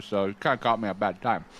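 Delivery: normal talking voice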